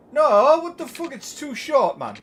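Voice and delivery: loud, high cry of disbelief; exasperated outburst. Real, not acted. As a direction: yell in frustration at the game. Annoyed outburst at the game.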